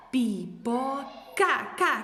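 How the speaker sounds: slow pipoca, each syllable held apart. Shown as a demonstration. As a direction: sound out pipoca slowly, isolating ca. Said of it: "sílaba por sílaba". (pipoca devagar, sílaba por sílaba)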